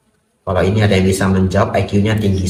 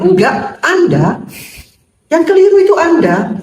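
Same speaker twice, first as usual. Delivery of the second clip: high-pitched comic rebuttal; accusing retort; 3 dB louder than usual. Acted. Monk silently answering a grumbling boss, accusing retort. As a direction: throw the blame back, pitched way up.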